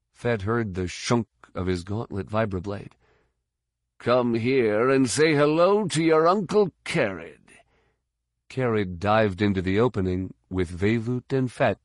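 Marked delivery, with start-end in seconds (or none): 4.0-7.4 higher-pitched mock-friendly taunt; taunting fighter. A narrator's reading. Fighter luring troopers with his vibroblade out, taunting. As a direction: beckon the enemy like a menacing uncle.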